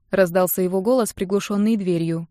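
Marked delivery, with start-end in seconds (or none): none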